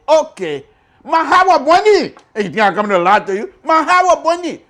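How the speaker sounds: high, loud, emphatic cry; host conceding defeat with a loud cry; loud exclamation. Real, not acted. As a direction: throw up your hands and cry it out. Exclaiming in surrender.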